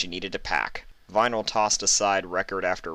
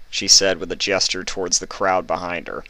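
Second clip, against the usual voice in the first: plain reading voice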